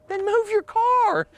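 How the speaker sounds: sarcastic retort. Retold or imitated. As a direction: drawl it slowly, high and mocking. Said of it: "I'm like".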